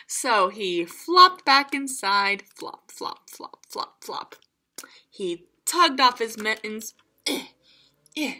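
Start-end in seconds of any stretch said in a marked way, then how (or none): 2.5-4.3 soft unvoiced flops; hushed flop flop; whispered flops. A narrator's reading. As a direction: breathe the sound effect. Sound effect whispered.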